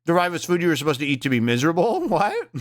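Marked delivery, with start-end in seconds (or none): 2.1-2.6 amused laughing what. Real, not acted. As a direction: laugh through the word. Laughing at a comment.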